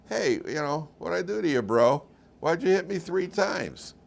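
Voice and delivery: a lightly voiced donkey, aggrieved; donkey's complaint. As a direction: play the hurt donkey, pleading.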